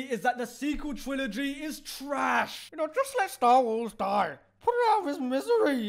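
bad old-director impression, a bit raccoon-like; lightly voiced elderly filmmaker; terrible George Lucas voice. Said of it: "that was my terrible George Lucas voice".

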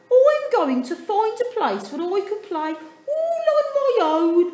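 high, loud, laughing little penguin; Dudley, fed up. A narrator's reading. Dudley complains to his family, high and loud.

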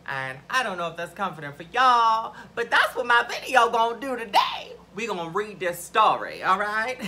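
loud, emphatic announcement; playful declaration. Real, not acted. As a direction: call it out big to the audience.

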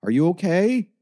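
high, loud, alarmed question; panicked shout rising at the end. A narrator's reading. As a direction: call out in a panic, pitch shooting up. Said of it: "she asked in a loud, panicked voice".

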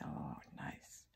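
very soft, voiceless whisper; whispered aside. Real, not acted. Reader reacts to the garden description, whispering. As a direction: murmur it privately under your breath.

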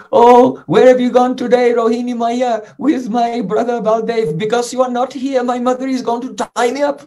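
high, plaintive calling voice; Krishna calling out. Acted. Child calling his absent other mother before being tied, pleading call. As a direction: call out for help on a sustained high pitch. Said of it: "Krishna was calling".

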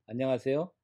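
ordinary delivery

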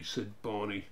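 ordinary delivery

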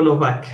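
"Novak" with a v sound in the middle; Polish W as V. Shown as a demonstration. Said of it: "la W suena como una V". ("Novak" con sonido de V, como la W polaca)

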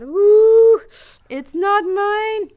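put-on high, slow longing child; a little girl drooling over candy; high wistful child's voice; tempted child.

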